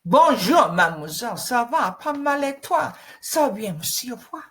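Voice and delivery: playful French accent, lilting. Shown as a demonstration. Coach experimenting with accents, playful French greeting in French accent. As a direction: put on a French accent, chatting both sides. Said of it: "inflections and even accents".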